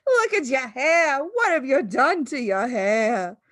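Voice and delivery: high horrified shriek slowing into a drawn-out wail. A narrator's reading. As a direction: screech and stretch the question out. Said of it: "the mother was shrieking".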